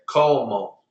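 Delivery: como stressed on CO, CO-mo; second-to-last stress. Shown as a demonstration. Teacher modelling como for the class, stressed on CO. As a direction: lean long on the first syllable of como. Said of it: "second to last syllable".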